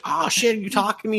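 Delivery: very high-pitched surprised cat; startled cat. Retold or imitated. Cat realises the man can hear him, high-pitched surprise. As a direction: jump up high, caught off guard. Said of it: "And he's like".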